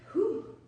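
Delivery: a high, loud whew after the burst; high exhale whoop. Real, not acted. Finishing a hard bike interval for viewers, loud high whew. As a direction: blow out a loud breath of relief.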